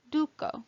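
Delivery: "duco" with a long oo in the first syllable; long U example. Shown as a demonstration. Teacher giving a long U example, "duco" with held oo. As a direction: hold the U in "duco" as oo. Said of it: "Long U".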